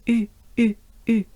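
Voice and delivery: "U" through a small mouth opening; short, repeated French "U" with a droopy face. Shown as a demonstration. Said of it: "it should sound like U".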